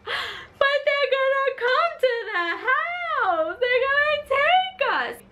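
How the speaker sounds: very high, slow mock wail; over-the-top tearful shriek of a child; drama-queen crying. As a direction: wail in hammed-up panic. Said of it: "I was the drama queen".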